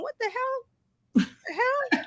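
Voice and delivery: high, thin, baffled "What the hell?"; imitating a stunned reader; shocked reaction. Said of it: "people going".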